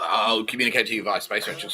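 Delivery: ordinary talk, nothing marked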